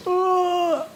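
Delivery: a high pinched grunt of struggle; mock strained cry; strained groan. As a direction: squeeze out a pained ugh. Being pulled apart in dream.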